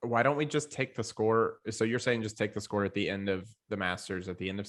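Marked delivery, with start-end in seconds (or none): none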